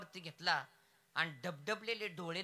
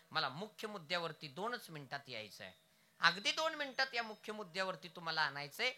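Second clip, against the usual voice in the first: ordinary talk, nothing marked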